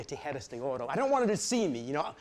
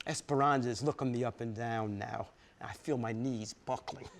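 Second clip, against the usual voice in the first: usual speaking voice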